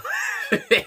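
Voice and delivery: sudden high burst of laughter; loud high laugh; real laugh. Real, not acted. Laughing about the old dog.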